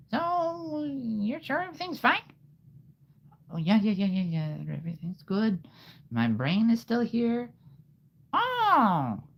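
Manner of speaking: overly sweet and fake cheerful; squeaky sing-song reply; chipper character. Story dialogue in high voice.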